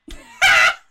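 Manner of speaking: sudden loud burst of laughter; a big laugh that comes out too loud. Real, not acted. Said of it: "Oh, that was too loud".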